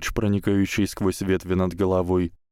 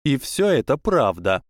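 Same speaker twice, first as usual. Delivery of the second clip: high, eager schoolgirl; bright proud young witch; girl voice; 6 semitones higher, 4 dB louder than usual. A narrator's reading. Tracey answers.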